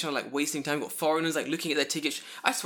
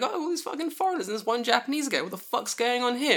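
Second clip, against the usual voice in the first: high, odd-voiced mock cop grumble; baffled cop; 10 semitones higher than usual. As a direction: grumble like a puzzled patrolman. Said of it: "And he's like".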